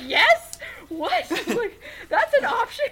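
high, loud shout of joy; excited disbelief. Retold or imitated. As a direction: blurt it out, thrilled and disbelieving. Starstruck fan offered a photo.

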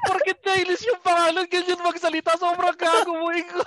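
high-pitched, incredulous laughing scolding; laughing protest. Real, not acted. Host calling out friend's put-on accent, laughing protest. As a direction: scold your friend through real laughter, voice way up.

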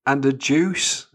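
high, loud, drawn-out disbelief; incredulous disgust. Retold or imitated. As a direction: stretch it out in mock outrage. Mock outrage at getting a juice.

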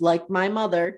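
usual speaking voice

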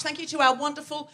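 ordinary talk, nothing marked

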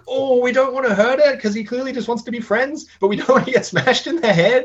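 high, loud mock-panicked kids' voices; imitating the kids. Kids panicking to the teacher about the monster, high mock voice. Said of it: "And they were like".

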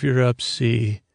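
ordinary talk

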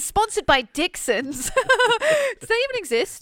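high, slow words through laughter; giggling at the joke sponsor; laughing. Real, not acted.

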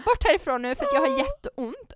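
high loud desperate plea; pained outburst. Retold or imitated. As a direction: push the child away in pain. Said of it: "man typ så här". (högt desperat utbrott av smärta)

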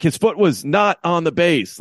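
slow, high-pitched complaint; mock a coach disputing a call, word by word; imitated indignant protest. Acts another coach disputing a call.

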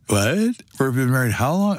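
a playful double take, voice jumping up; mock surprise. Realising forty-eight years flew by, mock astonished double take. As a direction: act as if the number just shocked you. Mock disbelief at marriage length.

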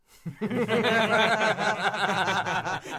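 high, loud burst of laughter; big real laugh. Real, not acted. Co-host hears the perfect Fassbender quote, cracking up laughing.

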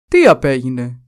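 a lightly voiced puzzled king; king asking. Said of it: "ρώτησε ο βασιλιάς".

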